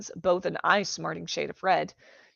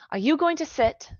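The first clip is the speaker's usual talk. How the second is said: put-on bright girl voice; 9 semitones higher, 4 dB louder than usual. Bright high question.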